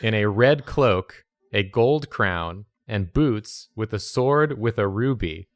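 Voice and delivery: slow, measured fairy-tale narration; slow proclamation of a royal portrait; grand storyteller. Acted. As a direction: unveil the painting like a herald. Describes the drawing grandly.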